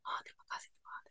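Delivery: very soft, whispered tearful thanks; normal gentle crying. Shown as a demonstration. Showing how people normally cry at church, hushed whispered weeping. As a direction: mimic a quiet, restrained weep. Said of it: "nangis normal".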